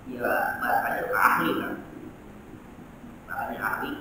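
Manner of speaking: bisikan pelan; berbisik. Real, not acted. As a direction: bisikkan sebagai komentar sampingan. Aside about an expert's wording. (suara berbisik pelan)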